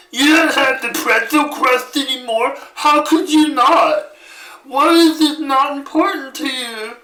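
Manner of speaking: very high, mocking screechy complaint; whiny customer impression. Ungrateful customer complains about pretzel crust, hammed-up whining. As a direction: wail like an outraged pizza customer. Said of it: "ungrateful bitch people who just".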